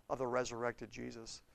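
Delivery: normal talking voice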